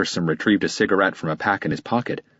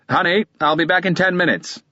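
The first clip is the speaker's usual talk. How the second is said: raised, higher call to someone indoors; husband calling to his wife inside the house, raised voice; 12 semitones higher, 3 dB louder than usual. A narrator's reading. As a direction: call back over your shoulder into the house. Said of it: "he called to the inside of the house".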